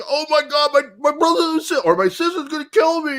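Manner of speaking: high-pitched mock-panicked kid; mimicking a frantic friend; imitated panic. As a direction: whine in hammed-up dread, voice way up. Voicing panicked Rolf.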